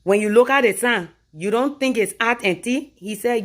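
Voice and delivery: usual speaking voice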